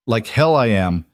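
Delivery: ordinary delivery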